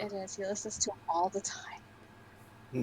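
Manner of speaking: whispered teasing aside; stage whisper. Real, not acted. Teasing aside about [name].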